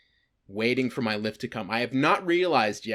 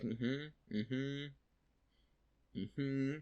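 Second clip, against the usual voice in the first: very soft absent hums; feigned listening; 14 dB quieter, much slower than usual. Retold or imitated. Avoiding the goblin man with a fake call, quiet feigned listening. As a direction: hum along barely audibly, as if half listening.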